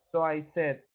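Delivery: ordinary delivery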